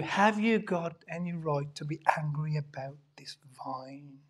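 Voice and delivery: hushed whispered question; gentle whisper. Acted. God gently asks Jonah a third time, whispering. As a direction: let the question fall in a whisper.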